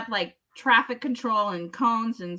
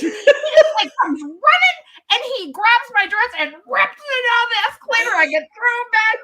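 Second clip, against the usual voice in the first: excited; 12 semitones higher, 7 dB louder than usual. Retold or imitated. Retelling the dress being ripped.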